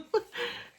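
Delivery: a sudden high breathy laugh through tears; emotional laugh. Real, not acted. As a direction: laugh it off, overcome with feeling. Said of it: "I'm so flippin' emotional".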